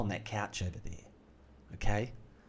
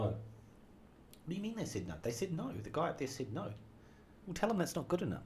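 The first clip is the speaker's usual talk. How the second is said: soft, higher muttered exchange; quiet voice; 6 semitones higher, 10 dB quieter than usual. Retold or imitated. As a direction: say it softly aside. Quiet acted back-and-forth.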